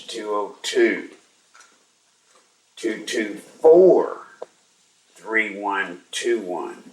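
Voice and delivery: slow, spaced-out digit groups; slow dictation. Real, not acted. Host gives listeners the switchboard number, slow dictation. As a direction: spell out the number slowly for note-takers.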